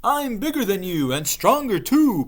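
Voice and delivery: high, loud bragging; boasting. Acted. Ghost bragging to the witch, boastful. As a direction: puff up and brag. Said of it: "boasted the ghost".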